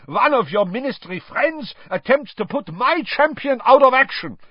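put-on bellowed, high-pitched accusation with a foreign accent; furious accented tirade; Karkaroff. A narrator's reading. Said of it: "he bellowed, pointing at Dumbledore".

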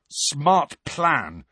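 mildly angry sarcasm; spat out. A narrator's reading. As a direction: spit the words at a friend. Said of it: "he spat at Hermione".

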